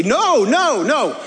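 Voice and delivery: loud retold no. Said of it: "And I loudly say".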